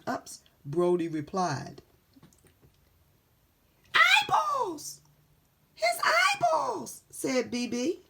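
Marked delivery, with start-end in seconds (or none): 3.9-7.0 horrified shout. Acted. Panicked shout.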